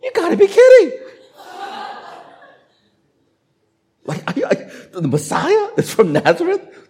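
high, loud, laughing scoff; laughing disbelief. Retold or imitated. Nathanael scoffing at a Messiah from Nazareth, laughing high. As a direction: laugh it off as absurd. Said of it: "Nathanael said".

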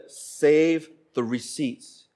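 very slow, measured repetition; wife's instruction. Retold or imitated. Wife repeating to puzzled husband, very slow. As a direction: stretch out SAVE and pause before "the receipts". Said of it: "She said".

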